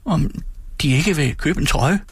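hesitant, timid request; trembling. A narrator's reading. Frightened boy offers his jacket for sale, trembling. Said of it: "svarede jeg skælvende".

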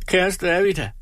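lightly voiced weeping woman; crying. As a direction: plead through mock tears. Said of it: "nu græd Tripstine".

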